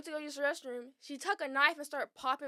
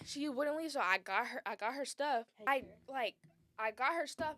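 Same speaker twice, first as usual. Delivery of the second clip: ordinary delivery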